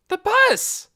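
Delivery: high, loud excited call; girl spotting the bus; excited cry. Acted. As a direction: spot it and cry out.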